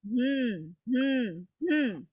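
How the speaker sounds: three separate soft hums; humming. Shown as a demonstration. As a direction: hum once for each syllable. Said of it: "I'm just humming".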